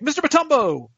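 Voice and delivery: high-pitched fan call; starstruck calling out. Retold or imitated. Calls out to his hero, retold.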